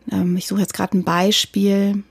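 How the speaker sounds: usual speaking voice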